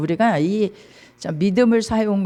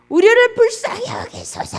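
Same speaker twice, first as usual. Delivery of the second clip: desperate crying out; 14 semitones higher, 4 dB louder than usual. Acted. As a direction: cry out for mercy with all your might. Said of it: "막 소리를 지릅니다". (높고 간절하게 부르짖는 소리)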